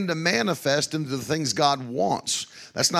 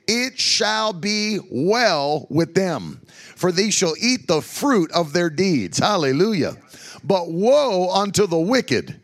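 slow, louder, lifted scripture reading; preaching scripture; 3 semitones higher, 3 dB louder, slower than usual. Acted. Preacher reads Isaiah to the congregation, slow and loud. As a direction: proclaim the verse slowly with weight.

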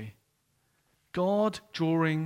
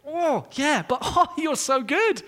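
laughing confession; 8 semitones higher, 4 dB louder than usual. Retold or imitated. Believer responds to God, laughing.

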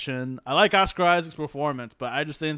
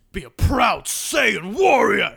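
proud, slow anime warrior, loud and raised; Vegeta; 6 semitones higher, 8 dB louder, slower than usual. Shown as a demonstration. Showing off his impression, loud slow proud warrior. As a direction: declaim it grandly, stretching each word. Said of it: "that was basically Vegeta right there".

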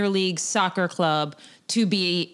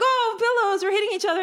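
high, silly mock delight; mock excited; 12 semitones higher than usual. Mocking pillow fights as one note, high silly glee. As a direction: play dumb and thrilled.

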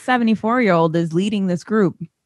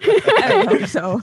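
high laughing voice; 12 semitones higher, much slower than usual. Real, not acted. High slow laughing reply.

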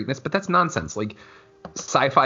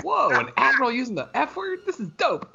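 high-pitched wowed reaction; amazed delight; 14 semitones higher than usual. Retold or imitated. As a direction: react like a thrilled, astonished fan. Retelling own amazed reaction.

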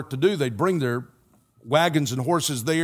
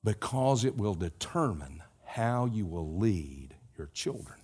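earnest closing appeal; 6 semitones lower, 6 dB quieter, slower than usual. Acted. Slow soft closing exhortation.

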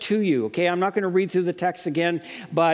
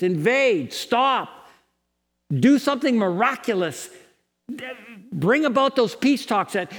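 urgent plea; 4 semitones higher than usual. Acted. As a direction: call out to God to act, begging. Pleading appeal to God.

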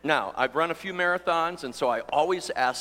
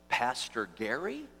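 lightly voiced tentative greeting, softer; young couple recognising pastor; 5 semitones lower, 8 dB quieter than usual. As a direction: softly mimic a couple asking if it's him.

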